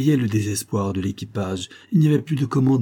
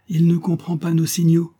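higher-pitched lieutenant's exclamation; exclaiming; 7 semitones higher, 3 dB louder than usual. A narrator's reading. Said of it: "s'écria le lieutenant". (s'exclamer, voix plus haute)

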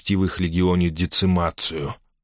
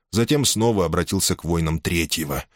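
ordinary delivery, nothing marked

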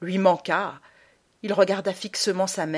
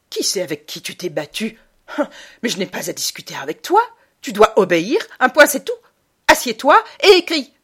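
building to a loud, high command; commanding mother; 4 semitones higher, 10 dB louder than usual. Acted. Mother ordering son to write, harsh. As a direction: end the discussion hard.

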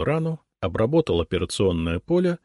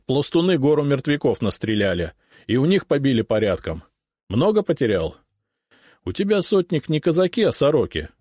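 higher, louder brisk officer voice; jovial sotnik; 8 semitones higher, 4 dB louder than usual. A narrator's reading. Sotnik telling the cossack officer what he heard, jovial. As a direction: rattle off the news with good humour.

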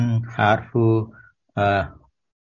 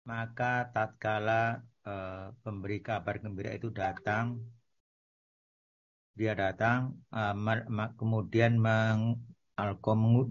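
walking away; 14 dB quieter than usual. Real, not acted. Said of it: "Ini suaranya jauh banget".

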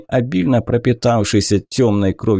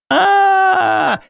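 long, very high scream of pain. Acted. Wounded hero screams while his wound is cut open, long high scream. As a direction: scream as the blade cuts into the wound. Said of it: "Я заорал".

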